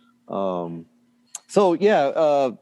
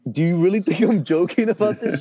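high-pitched, lightly exasperated retort; mock incredulous; 8 semitones higher than usual. Husband retelling his answer to his doubting wife, incredulous. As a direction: imitate protesting that you are serious. Retold argument with disbelieving wife.

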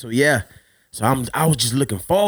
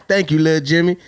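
normal talking voice

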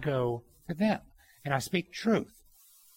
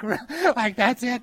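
amused disbelief; 9 semitones higher, 7 dB louder, slower than usual. Retold or imitated. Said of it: "I'm like".

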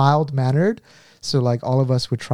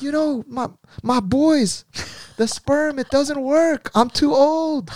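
high, whining complaint; high whiny coach; 12 semitones higher, slower than usual. Retold or imitated. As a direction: whine like a sad older coach. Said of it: "He's like".

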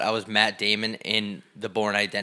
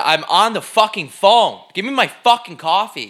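angry retort; 8 semitones higher, 8 dB louder than usual. Retold or imitated. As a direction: spell it out to her, fuming. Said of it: "I looked at her and said".

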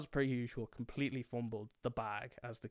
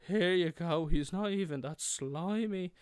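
higher, louder sing-out reassurance; chirpy show-off kid; 7 semitones higher, 6 dB louder than usual. Retold or imitated. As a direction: hand it over breezily in a raised voice. Kid passes snake to a girl.

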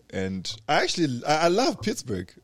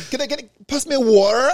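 mock American; 11 semitones higher, 6 dB louder than usual. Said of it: "a friend of mine was like".

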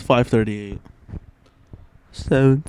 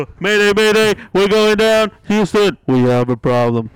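mayday radio call; 7 semitones higher, 5 dB louder than usual. Retold or imitated. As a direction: act out a crashing plane radio. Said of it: "that was my voice changer yesterday".

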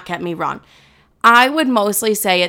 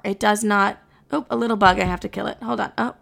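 ordinary talk, nothing marked

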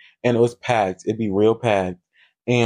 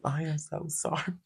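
much softer, a near-hushed "so sorry"; hushed apology; 3 semitones higher, 12 dB quieter than usual. Retold or imitated. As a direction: apologise quietly, embarrassed. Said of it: "I was like".

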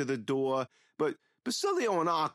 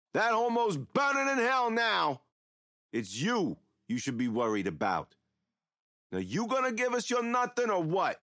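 yelling mobster; 9 semitones higher than usual. A narrator's reading. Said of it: "Basilio yells".

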